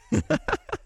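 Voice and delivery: high-pitched giggle; high laugh to yourself; real laugh. Real, not acted. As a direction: laugh at your own cheeky joke. Laughing at own innuendo.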